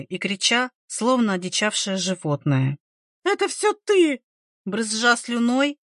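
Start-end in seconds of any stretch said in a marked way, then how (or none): 3.2-4.2 very high, frantic accusation; grief-crazed cry. A narrator's reading. Grieving mother blaming the midwife, crazed cry. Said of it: "выкрикнула обезумевшая от горя мать".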